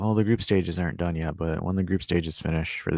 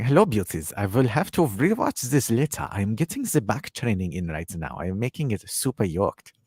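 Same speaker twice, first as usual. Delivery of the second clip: put-on foreign accent, playful imitation; [name]'s put-on accent. Host reads a viewer's back-training message, mock foreign accent. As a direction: imitate a chat regular in a thick mock accent. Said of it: "I gotta do it with the accent".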